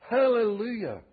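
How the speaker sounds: high, loud cry of praise; shouted hallelujah. Acted. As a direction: shout it out like a heaven-wide crowd. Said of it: "in heaven shouting".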